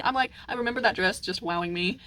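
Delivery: ordinary talk, nothing marked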